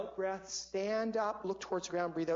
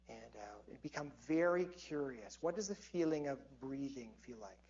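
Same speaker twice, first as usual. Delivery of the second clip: calming breath guide; 4 semitones lower than usual. Real, not acted. Leads a calming slow-breath exercise.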